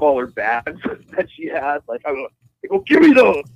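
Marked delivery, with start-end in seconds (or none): 2.7-3.4 high, loud mock demand; hammed-up yell while grabbing at something; acted snatching demand. Driver grabbing the woman's bags, high loud demand.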